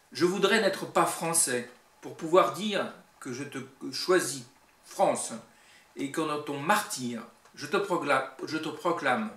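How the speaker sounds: stressed words punched out between pauses; poem recitation. Acted. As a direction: declaim verse with measured pauses.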